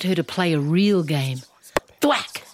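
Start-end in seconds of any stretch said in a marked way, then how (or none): 2.0-2.4 sound effect. A narrator's reading. Ball hitting sound.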